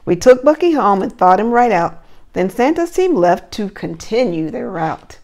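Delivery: lively rhyming storybook read; reading to children. Acted. Teacher reading a Christmas story to her class, lively storybook voice. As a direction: read the verse aloud with bounce for little ones.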